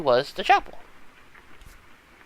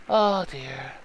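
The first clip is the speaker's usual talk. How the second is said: usual speaking voice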